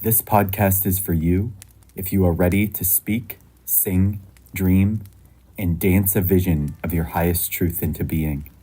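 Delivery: measured, projected announcer read; podcast intro. Acted. Host invites listeners around a sacred fire, scripted intro read.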